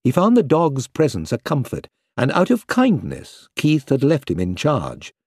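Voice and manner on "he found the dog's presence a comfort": usual speaking voice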